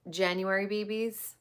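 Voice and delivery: ordinary delivery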